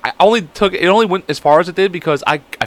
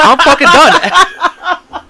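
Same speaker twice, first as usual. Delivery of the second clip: loud, very high, drawn-out protest; hammed-up blowup, breaking into laughter; exasperated outburst; 13 semitones higher, 7 dB louder, much slower than usual. Retelling his snap at the friend who tripped him, exasperated outburst.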